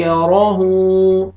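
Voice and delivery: "यराहू" with the final hu held long; slow recitation, final vowel lengthened; stretched ending. Shown as a demonstration. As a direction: draw out the last vowel. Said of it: "आपने हाँ को खींचना है".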